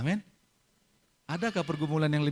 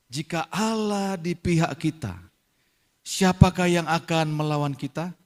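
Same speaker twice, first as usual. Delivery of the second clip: forceful scripture reading; 3 semitones higher, 8 dB louder than usual. Acted. Preacher reading Romans to the congregation, loud and declamatory. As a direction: proclaim each phrase with full force.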